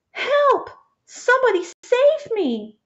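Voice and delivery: high, desperate call for help; shouting for help. A narrator's reading. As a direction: shout it out to be rescued. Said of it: "he shouted".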